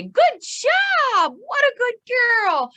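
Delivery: very high, loud delighted praise; gushing dog praise. Shown as a demonstration. Trainer shows how to praise her adult dog, high-pitched gushing. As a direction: squeal it like rewarding a beloved dog.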